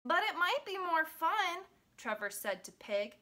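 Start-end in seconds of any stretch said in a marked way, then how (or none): 0.1-1.7 higher-pitched storybook character voice; lightly voiced hopeful dog. A narrator's reading. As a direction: voice a little dog making a suggestion.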